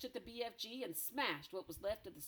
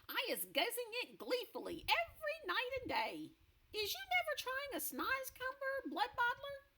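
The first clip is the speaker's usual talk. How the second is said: BFG voice; 4 dB louder than usual. Acted.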